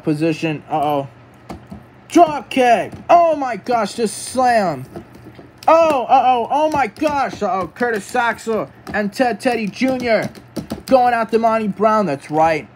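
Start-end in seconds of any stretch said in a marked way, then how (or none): 2.1-12.1 high-pitched wrestling commentary; excited play-by-play. Pretend. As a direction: call each hit as a shock.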